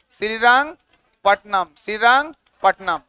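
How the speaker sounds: Srirangapatna spelled out slowly, syllable by syllable; slow it down so the class can follow; dictating a hard name slowly to students; slow dictation. Shown as a demonstration. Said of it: "अभी हम थोड़ा धीरे धीरे बोल रहे हैं".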